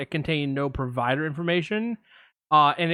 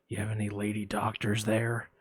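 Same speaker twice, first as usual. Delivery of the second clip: lurk low like a shady caller; creepy caller; 6 semitones lower, 7 dB quieter than usual. Retold or imitated. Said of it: "I'm gonna play both parts".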